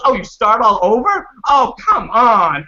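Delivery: high, loud mock exasperation; hammed-up high-pitched grandma complaint; exasperated grandma. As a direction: imitate grandma protesting, dragging out the final words. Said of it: "my grandma was hot".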